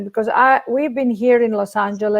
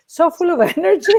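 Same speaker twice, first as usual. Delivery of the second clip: real high-pitched laugh breaking into the words; 9 semitones higher, faster than usual. Real, not acted. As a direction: crack up laughing as you finish the question. Delighted at host's high energy.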